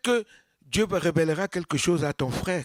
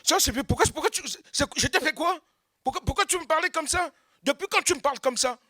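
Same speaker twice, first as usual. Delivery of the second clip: high, fast offended questions; mock indignant outburst; 10 semitones higher, faster than usual. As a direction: get defensive fast. Acted quarrel, angry reply.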